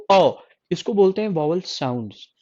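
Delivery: ordinary talk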